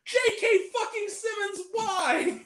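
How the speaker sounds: high-pitched, incredulous rant; exasperated outburst. Real, not acted. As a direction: cry out in exasperated disbelief. Mock outrage at actor's film choice.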